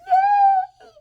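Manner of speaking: very high, loud squeal; piercing mock-delighted cheer; happy Stepford wife. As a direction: squeal with manic fake glee. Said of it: "my happy Stepford wife voice".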